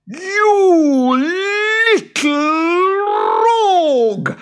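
very high, loud furious creature roar; furious high boggart scream. Acted. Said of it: "roared the boggart".

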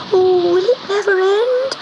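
high, wailing little cat; a small cat begging the storm to stop; frightened kitten voice. Acted.

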